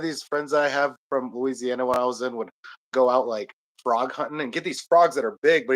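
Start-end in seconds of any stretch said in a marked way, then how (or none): none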